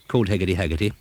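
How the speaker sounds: plain reading voice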